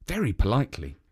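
ordinary delivery, nothing marked

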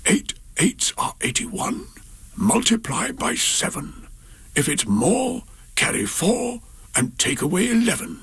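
high breathy whisper, slow and secretive; an emperor's secret whispered chant; whispered nonsense rhyme. Acted. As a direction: whisper the silly sum to the sky. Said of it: "he would whisper and whisper".

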